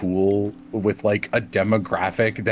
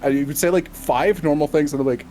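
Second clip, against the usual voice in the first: ordinary delivery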